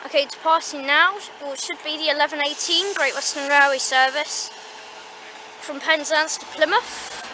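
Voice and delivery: train announcer voice; station announcer. Pretend. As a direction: announce it like a platform tannoy.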